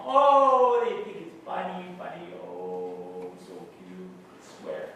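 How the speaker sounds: mock-adoring parents cooing over a child; doting parents. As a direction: gush in imitation, then sink low. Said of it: "And then Dad and Mom".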